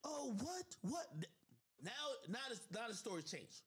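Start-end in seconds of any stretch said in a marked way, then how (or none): 0.0-1.1 much higher, faked shock; imitating her fake surprise; mock surprise. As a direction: play dumb and gasp. Mimicking her feigned shock.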